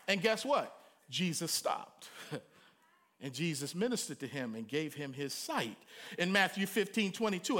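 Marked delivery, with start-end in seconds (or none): none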